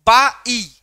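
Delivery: "बा ई" pulled apart slowly and loudly; stressed syllable. Shown as a demonstration. Teacher breaking down bicycle's first syllable, "बा ई" stressed hard. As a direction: stretch "बा", then punch "ई". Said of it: "ये आपका stressed है".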